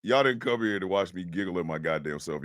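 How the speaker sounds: usual speaking voice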